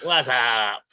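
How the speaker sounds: long, stretched-out comic "whassup"; drawn-out catchphrase. Pretend. Co-host rolling out the guest, drawn-out joke greeting. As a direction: stretch the words out goofily.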